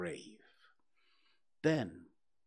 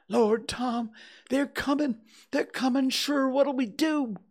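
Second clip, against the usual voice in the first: put-on high, louder terrified boy; panicked; 13 semitones higher, 6 dB louder than usual. Huck fearing the spirits are coming, panicked high voice. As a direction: voice climbing with fright.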